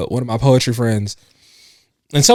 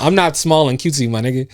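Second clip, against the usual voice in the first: usual speaking voice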